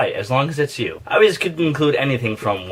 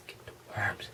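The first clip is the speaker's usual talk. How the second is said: hushed urgent whisper; 5 semitones lower, 21 dB quieter, faster than usual. Acted. Whispering in the skit about the worms. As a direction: breathe it out with no voice.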